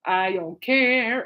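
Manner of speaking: slow, drawn-out mock indifference. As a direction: stretch the final word long. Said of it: "just hearing [name] going".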